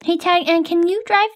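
ordinary delivery

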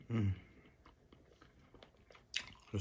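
eating and savoring a snack; chewing while talking. Real, not acted. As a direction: mouth partly full of food. Seen 1: Head down, mouth working, hand to mouth between "mm" sounds.

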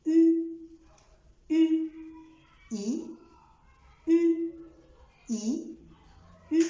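"إي" with spread lips, "أو" with rounded lips; alternating e and o. Shown as a demonstration. Teacher drilling é against o. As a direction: switch between a smile and a pout. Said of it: "نضم شفايفنا".

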